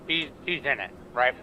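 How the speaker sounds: ordinary talk, nothing marked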